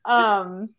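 loud, high, laughing admission. Real, not acted. Host relating to a comedy skit about post-quarantine parties, laughing loudly. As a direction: burst out laughing through the words.

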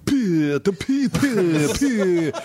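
put-on high silly kid voice; childish taunt. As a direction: taunt like a little boy. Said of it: "and he's like".

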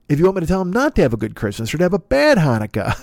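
ordinary delivery

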